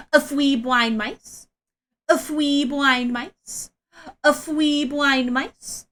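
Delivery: "A fwee blind mice" said slowly, with "fwee" for "three", in cutesy uwu speech; uwu talk. Shown as a demonstration. Streamer reciting a rhyme to chat in slow uwu baby talk. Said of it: "I'm now going to talk in uwu".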